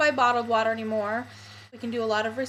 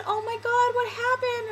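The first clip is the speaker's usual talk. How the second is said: much higher, mock alarmed friend; imitating startled friend; 12 semitones higher than usual. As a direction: play the shocked friend asking what's wrong. Friend sees her crying.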